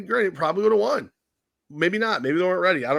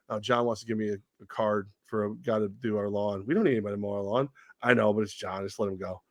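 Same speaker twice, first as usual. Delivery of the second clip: low, much softer, muttered reply; hushed aside to wife; 8 semitones lower, 7 dB quieter than usual. Retold or imitated. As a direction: keep it down so the neighbour won't hear. Husband talks with wife.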